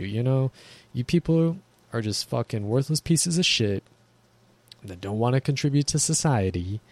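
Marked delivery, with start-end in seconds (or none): none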